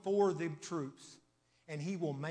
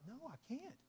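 put-on little boy; 9 semitones higher, 10 dB quieter than usual. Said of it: "his little boy said".

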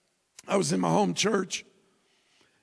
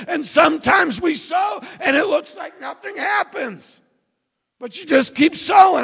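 raised, high-pitched preacher's voice; full-force preaching; 7 semitones higher, 7 dB louder than usual. Real, not acted. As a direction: drive it home to the congregation.

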